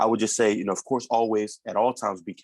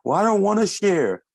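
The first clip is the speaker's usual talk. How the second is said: put-on higher, louder defiant kid; defiant child; 9 semitones higher, 5 dB louder than usual. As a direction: protest like a stubborn child. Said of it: "son refuses to share".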